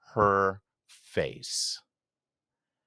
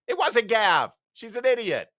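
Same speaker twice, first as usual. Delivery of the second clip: mocking jab; 14 semitones higher, 4 dB louder than usual. Real, not acted. Mocking interjection into book reading.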